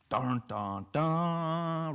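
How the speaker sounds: hammed-up suspense music from the mouth; mock dramatic sting. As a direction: sing a mock ominous sting. Mock dramatic sting after quote.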